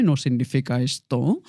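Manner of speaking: mock engolada voice; pompous, plummy voice. Shown as a demonstration. Coach mocking what placed voice is not, pompous voice. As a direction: put on an overblown throaty placed voice. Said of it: "impostada no significa engolada".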